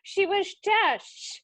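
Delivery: high, drawn-out mock Dutch accent; imitated Dutch accent. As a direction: stretch it out in a playful Dutch accent. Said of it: "like, amazing accent".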